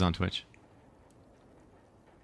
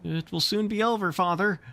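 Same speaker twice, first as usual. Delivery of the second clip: put-on high, cheeky boy's voice; 10 semitones higher than usual. John teases his father about the medicine, high and cheeky. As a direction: tease Father, pitched up like a kid. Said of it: "John said cheekily".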